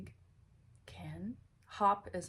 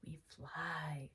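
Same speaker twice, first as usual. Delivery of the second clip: whispering; 3 semitones lower, 8 dB quieter than usual. Shown as a demonstration. Whispered answer to child.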